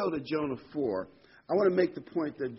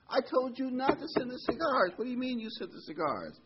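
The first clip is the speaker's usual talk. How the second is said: high-pitched, incredulous scolding; mock outrage; 12 semitones higher than usual. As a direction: imitate an aghast lawyer, voice shooting up. Lawyer aghast at client in story.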